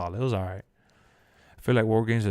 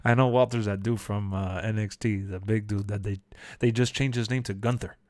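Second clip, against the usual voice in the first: ordinary talk, nothing marked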